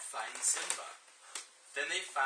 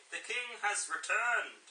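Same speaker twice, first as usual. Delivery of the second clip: high put-on Yorkshire old baboon; Yorkshire-accented wise baboon; Yorkshire Rafiki; 7 semitones higher than usual. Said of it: "what voice you're gonna do for Rafiki".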